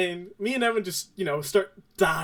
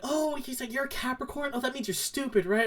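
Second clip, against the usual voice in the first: high, softer mock-girl voice; mocking girl imitation; 7 semitones higher, 5 dB quieter than usual. Mocking girls' reaction acted out.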